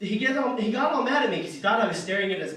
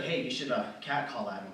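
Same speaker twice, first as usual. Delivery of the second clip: low, under-the-breath suggestion; quiet, conspiratorial nudge; low nudge to a buddy; 7 semitones lower, 7 dB quieter than usual. Acted. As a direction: say it low and sly to a pal. Buddy nudging him quietly.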